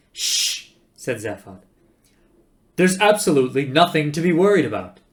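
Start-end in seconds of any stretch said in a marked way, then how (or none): 0.1-0.7 loud unvoiced hush; sharp shush. Zaphod hushes Arthur. 2.8-5.0 loud, raised-pitch denial; forceful insistence. Acted. Zaphod insists, nearly shouting.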